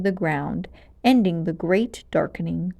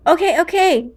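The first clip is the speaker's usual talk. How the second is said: gasping; 9 semitones higher, 6 dB louder than usual. Acted. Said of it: "Malgosia gasped".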